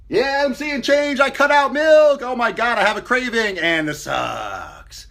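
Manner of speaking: high, loud and slow gushing; overexcited newbie. Retold or imitated. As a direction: gush like a hyped beginner. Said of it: "That's what people do".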